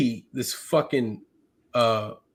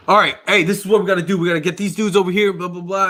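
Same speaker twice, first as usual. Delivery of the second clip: higher, brisk taking-charge voice; retold urgent orders; 6 semitones higher, 4 dB louder, faster than usual. Medic recalls directing his men under fire, urgent commanding voice. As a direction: act out rallying the squad, raised pitch.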